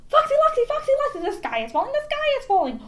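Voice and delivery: high, loud, frantic yell with a laugh; yelling hen. A narrator's reading. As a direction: squawk the alarm at the fox. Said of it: "yelled Chicken Licken".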